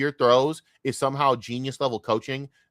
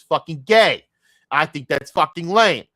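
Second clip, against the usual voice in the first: rising, loud angry rant; real frustration, high and loud; mad outburst; 5 semitones higher, 8 dB louder than usual. Real, not acted. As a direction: build to a yell on the last name. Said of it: "I'm sorry, I'm still mad".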